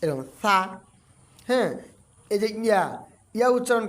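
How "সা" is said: "সা" softly from the tongue tip; soft articulation. Shown as a demonstration. Teacher showing the letter's articulation point, soft "সা". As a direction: let "সা" out gently off the tip of the tongue. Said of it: "নরমভাবে আদা হবে".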